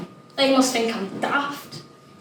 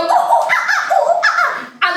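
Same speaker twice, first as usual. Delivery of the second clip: very high, loud monkey hoots; monkey calls; 7 dB louder than usual. Retold or imitated. Child imitating baboons with loud monkey hoots. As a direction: hoot like a baboon. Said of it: "They go".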